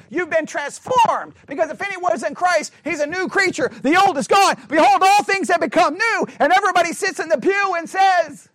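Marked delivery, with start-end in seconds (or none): none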